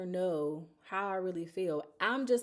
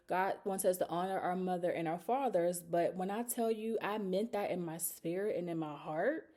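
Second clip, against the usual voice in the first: normal talking voice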